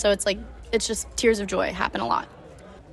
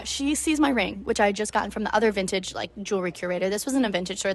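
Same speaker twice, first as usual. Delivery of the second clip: normal talking voice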